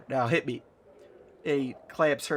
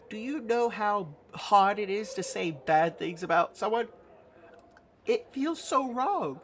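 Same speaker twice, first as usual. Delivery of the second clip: higher-pitched, plaintive Nanny voice; mild distress; 5 semitones higher than usual. Acted. Nanny confesses to player that meanness feels wrong, higher and plaintive. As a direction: protest helplessly, rising at the end. Character dejected at failing.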